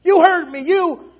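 high, loud, strained outburst; hammed-up furious grudge-holder; mock throttling rage. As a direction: act out choking someone while accusing them. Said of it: "got our hand around their throat".

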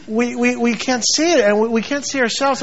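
ordinary delivery

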